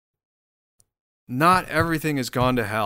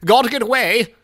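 high, loud wail of terror; hammed-up yelling while fending someone off; losing badly and crying out; mock panicked resister; 9 semitones higher, 8 dB louder than usual.

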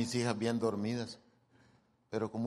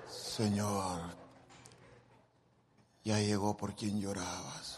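mock pious prayer; 5 semitones lower, 7 dB quieter, slower than usual. Mock pious prayer, eyes closed.

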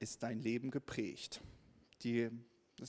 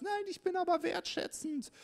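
mock indignant. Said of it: "Und du sagst".

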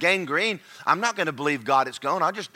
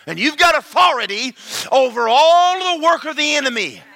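full-force preaching; 7 semitones higher, 6 dB louder than usual. Acted. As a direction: proclaim it with rising fervor.

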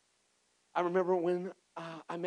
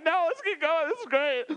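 mock tearful eagerness; 12 semitones higher, 10 dB louder than usual. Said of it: "I mean, I'm crying".

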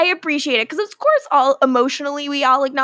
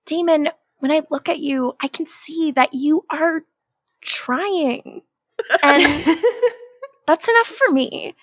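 higher, breathy-sounding mock tenderness; mock sappy Elena; 5 semitones higher than usual. Podcaster mocking Elena's sappy speech to Damon, higher. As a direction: lay the sincerity on thick.

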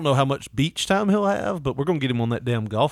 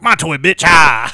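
loud, sudden grab-it shout; possessive dog; 4 semitones higher, 11 dB louder, slower than usual. Retold or imitated. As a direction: snap it back like a greedy dog. Dog snatches toy.

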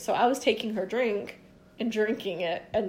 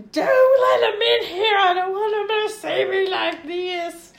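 high, loud mock sobbing; hammed-up tearful pleading, drawn out; drunken crying wail; 10 semitones higher, 7 dB louder than usual. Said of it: "yelling and screaming and crying".

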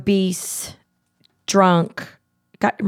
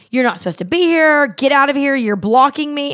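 mock yell; 6 semitones higher, 3 dB louder than usual. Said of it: "he starts yelling at me".

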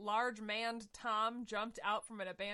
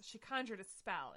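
usual speaking voice

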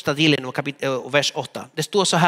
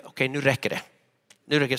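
inner irritation; 5 semitones lower, 6 dB quieter, much faster than usual. Retold or imitated. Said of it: "Så jag tänkte".

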